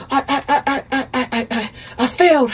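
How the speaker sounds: acted stuttering excuse; flustered stammer. Caught husband confessing to his wife, flustered stammering. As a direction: stammer on the first word before confessing.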